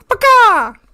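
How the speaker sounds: one loud, high hen squawk; chicken squawk. Acted. The chicken calls out from the coop, squawking loudly. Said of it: "the chicken".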